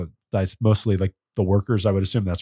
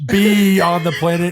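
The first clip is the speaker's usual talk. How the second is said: high, slow, loud repeat; mock outrage at pun; 9 semitones higher, 6 dB louder, slower than usual. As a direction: drag out the pun in disbelief. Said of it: "Is that a freaking pun?".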